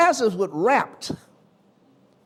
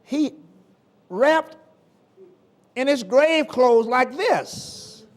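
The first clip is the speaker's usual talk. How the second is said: higher-pitched, drawn-out preaching; 8 semitones higher, 6 dB louder, slower than usual. Acted. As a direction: make the church see the dead man. Preacher describes the miracle.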